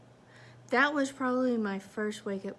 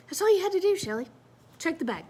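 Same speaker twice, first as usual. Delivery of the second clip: higher, louder scold that drops off; self-scolding; 7 semitones higher, 5 dB louder, much faster than usual. Retold or imitated. Scolding herself in the car over the bag, sharp scold. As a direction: snap it at yourself.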